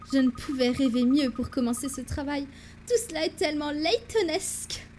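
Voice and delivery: enthusiastic assistant. Acted. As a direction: play the keen assistant, lifting the phrases. Plays Amy reading game dialogue.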